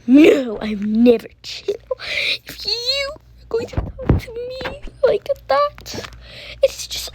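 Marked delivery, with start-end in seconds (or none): 2.5-7.1 high, hushed reproach; whispered scold. Real, not acted. Girl whispering a scolding to her sibling.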